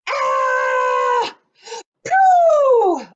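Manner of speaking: very high, loud scream; screamed and hollered. Retold or imitated. Seed being spat out, screaming and hollering. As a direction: shriek it as you get spat out. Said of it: "I screamed and I hollered".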